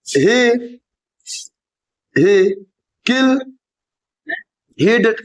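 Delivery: word by word with long pauses; slow dictation. Acted. Teacher dictating a negative sentence, slow and spaced. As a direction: dictate each word so the class keeps up.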